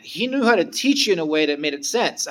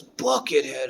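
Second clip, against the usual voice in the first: musing whisper; slower than usual. Retold or imitated. Teacher musing on name.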